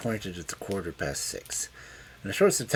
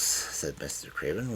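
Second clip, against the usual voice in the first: plain reading voice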